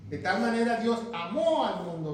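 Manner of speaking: "amó" stressed on its final ó; acento en "amó". Shown as a demonstration. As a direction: lean on the last syllable of "amó". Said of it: "con acento en la O". (énfasis en la ó final de "amó")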